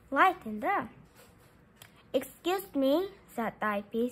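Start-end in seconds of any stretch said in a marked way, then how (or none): none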